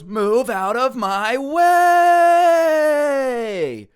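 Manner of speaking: long high yell, stretched final word; screaming. Acted. Student slams his foot down at teacher, hammed-up scream. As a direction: roar it slowly, dragging out WAY. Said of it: "screams at the same time".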